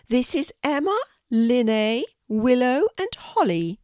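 a kindly Queen welcoming a guest, pitched well up. Acted. The Queen inviting a shy girl to tea, high regal voice. Queen names her corgis.

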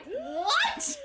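very high, drawn-out, laughing disbelief; mock shock. Podcaster acts out shock at the marriage reveal, astonished. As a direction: squeal it as a stunned reader.

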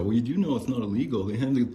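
snide corporate drone lecturing the customer; mocking eBay cronies. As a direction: parody a condescending support rep. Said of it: "they'll have all these little cronies go".

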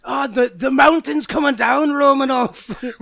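high, loud panicked Scottish-Russian voice; Scottish Russian accent. Acted. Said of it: "a bunch of Russians with Scottish accents".

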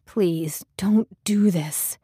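slow, understated plea; whiny pleading. A narrator's reading. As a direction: plead slowly, a touch whiny. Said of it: "He hated to sound so whiny".

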